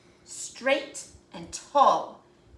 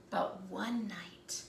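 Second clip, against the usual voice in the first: ordinary delivery, nothing marked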